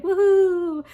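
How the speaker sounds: loud, high carefree cheer, imitating him; mock whoop. Vet character cheering as he joins the fight, mock whoop. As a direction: whoop it up like the goofy hippie vet.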